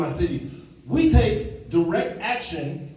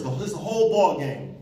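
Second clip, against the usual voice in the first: normal talking voice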